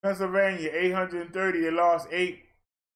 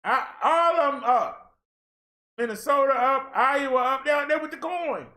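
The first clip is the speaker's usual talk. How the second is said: excited rant; 7 semitones higher than usual. Real, not acted. Excited about rising numbers.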